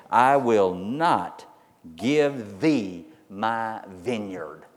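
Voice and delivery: very slow, weighted vow; slow solemn vow. Acted. Preacher urges the church to stand, slow and solemn. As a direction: drag each word out like an oath.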